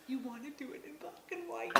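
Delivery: hushed mock excited whisper; whispering his eager question; retold excitement. Husband's eager question, retold in a whisper. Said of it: "He was all excited".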